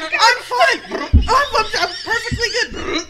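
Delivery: mocking her drunk friend's protest, laughing; laughing mock insistence. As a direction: squeal it like a tipsy friend swearing she's fine. Mimics drunk friend insisting fine.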